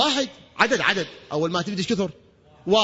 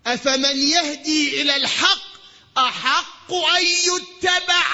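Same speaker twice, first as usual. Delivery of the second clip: preaching at full force; 8 semitones higher, 4 dB louder than usual. Acted. Preacher reciting the Quranic verse to the congregation, full force. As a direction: proclaim the verse like a call to the crowd.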